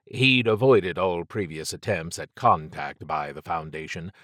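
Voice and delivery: ordinary delivery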